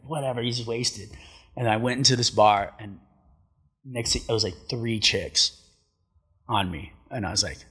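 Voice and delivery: ordinary delivery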